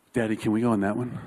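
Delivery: put-on quick, low, hushed-in-awe child's question; lightly voiced daughter. Daughter asking dad about the coaster, lightly imitated awe. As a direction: imitate a little girl asking in one quick rush. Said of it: "and she's like".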